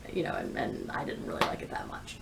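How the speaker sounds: a guilty little whisper of disappointment; whispered confession. Real, not acted. Booktuber confides her letdown to viewers, whispered. Confessing dislike of favourite author.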